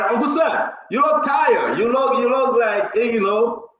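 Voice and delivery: teasing call-out to a tired guest. Real, not acted. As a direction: call out across the room to him. Calling out to a guest.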